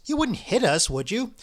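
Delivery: pitched high, pleading; fearful old man begging; croaking plea. A narrator's reading. Ippolit pleading with the chess players, croaking plea. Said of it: "in a croaking voice".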